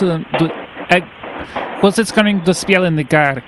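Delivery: ordinary talk, nothing marked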